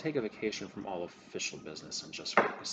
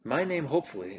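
emphatic; 9 semitones higher, 10 dB louder than usual. Acted. NPC hopes to stay unnamed.

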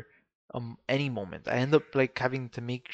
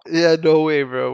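loud protest; 3 semitones higher, 12 dB louder, slower than usual. Real, not acted.

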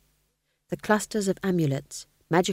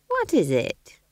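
Nanny curious; 4 semitones higher, slower than usual. A narrator's reading. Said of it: "Nanny asks the king".

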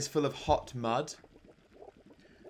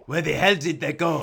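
put-on louder, baffled French-accented goblin; startled goblin; 3 semitones higher, 8 dB louder than usual. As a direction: exclaim in comic bewilderment. Goblin startled by a stealth roll.

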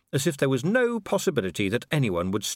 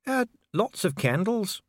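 high, slow, hesitant grumble; grudging mutter; 10 semitones higher than usual. A narrator's reading. Dwarf official answering the gushing Duchess, grudging mutter. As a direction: answer slowly and reluctantly. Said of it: "Dee muttered, glaring at Vimes".

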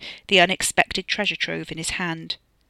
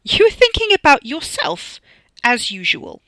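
high, loud accusation falling to a bitter finish; scathing raised-voice comeback; angry retort; 8 semitones higher, 8 dB louder than usual. A narrator's reading. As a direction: accuse him, then drop to scorn. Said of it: "Tina retorted".

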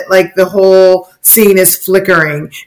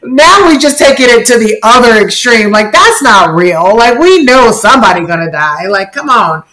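mock protest; 3 semitones higher, 4 dB louder than usual. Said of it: "now you're like".

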